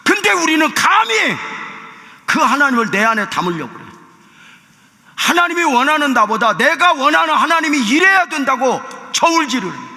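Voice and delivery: loud, high, impassioned sermon delivery; sustained high-pitched preacher's exhortation; full-force preaching. Real, not acted. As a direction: cry it out to the congregation.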